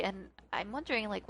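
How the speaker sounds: ordinary delivery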